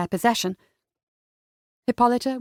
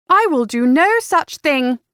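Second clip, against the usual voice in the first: higher, louder, slow indignant huff; 5 semitones higher, 5 dB louder than usual. A narrator's reading. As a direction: refuse him, offended and stubborn. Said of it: "she huffed".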